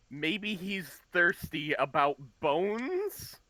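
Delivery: mocking quote. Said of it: "Did you just say".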